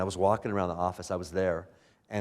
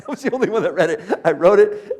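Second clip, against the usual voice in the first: laughing; 9 semitones higher, 10 dB louder than usual. Real, not acted. Repeats punchline while laughing.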